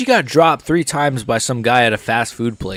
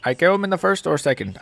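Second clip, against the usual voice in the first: a weak attempt at an Indian accent; 4 dB quieter than usual. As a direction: imitate the fighter's accent, half-hearted. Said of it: "How does Anshul Jabli talk? He's like".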